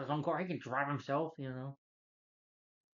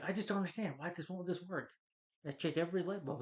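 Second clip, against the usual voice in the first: higher-pitched, mildly exasperated complaint; lightly acted bafflement, pitched up; baffled Clark; 6 semitones higher than usual. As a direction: play a frustrated man puzzling it out. Acts out baffled Clark over lights.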